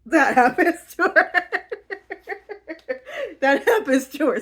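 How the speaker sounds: giggling, barely getting it out; words breaking into real laughter; laughing through words. Real, not acted. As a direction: laugh while you say it.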